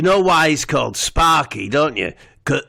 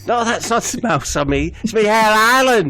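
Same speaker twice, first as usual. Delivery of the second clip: higher, louder indignant Steve; voicing Steve; 9 semitones higher, 4 dB louder than usual. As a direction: insist on the hair island, higher. Steve answers the maitre d'.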